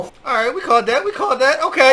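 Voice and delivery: celebrating getting the call right; climbing from pleased to a loud excited yell; hyped, building. Real, not acted. Hyped that his call came true.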